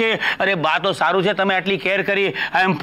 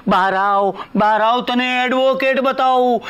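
slow, stretched, pompous announcement; drawn-out showman's self-quote; grand re-enactment; slower than usual. Retold or imitated. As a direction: draw out each title with mock reverence. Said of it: "એટલે મારો કહેવાનો મતલબ એમ છે".

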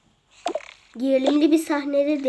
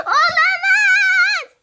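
dramatic wail; 6 dB louder, much slower than usual. Acted. Dramatic cry again.

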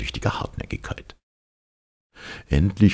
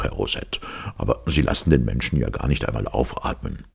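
usual speaking voice

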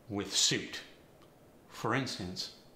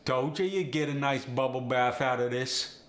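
cockney accent; 2 semitones higher, 5 dB louder than usual. Shown as a demonstration. Closing line in Cockney slang.

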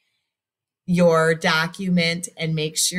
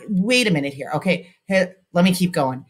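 ordinary talk, nothing marked

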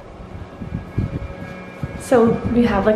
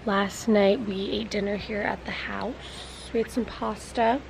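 soft, hushed morning chat; quiet morning voice; 7 dB quieter than usual. Real, not acted. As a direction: talk softly so nobody wakes. Quiet morning coffee talk.

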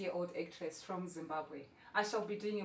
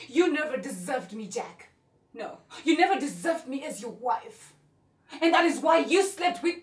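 loud outburst, building higher; angry, raised voice; furious accusation; 5 semitones higher, 11 dB louder than usual. Acted. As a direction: throw the blame at him, louder and louder. Acted accusation of cheating husband.

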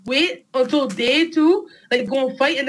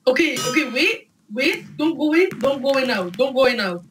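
normal talking voice

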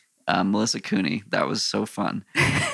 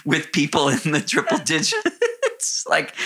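higher voice breaking into real laughter; laughing; 4 semitones higher than usual. Real, not acted. Runner recalls walking among elite bib numbers, laughing. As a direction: laugh through the words at the absurdity. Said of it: "I'm laughing because of how ridiculous".

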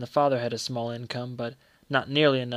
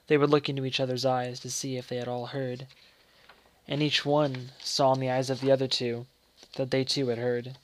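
ordinary delivery, nothing marked

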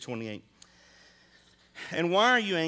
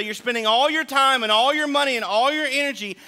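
louder, higher preaching rebuke; Jesus rebuking; 5 semitones higher, 6 dB louder than usual. Retold or imitated. Jesus warning hoarders about their wardrobes, louder and higher. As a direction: thunder it like a prophet calling out greed. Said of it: "And Jesus would say".

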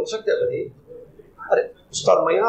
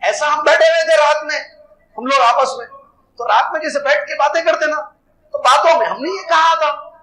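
pleading hypocrites; 6 semitones higher than usual. Retold or imitated. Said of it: "اور انہوں نے کیا کہا؟".

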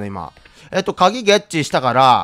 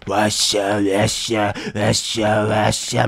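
imitating Electro voice; 9 semitones lower, 5 dB quieter, slower than usual. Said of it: "エレクトロの時みたいな声".

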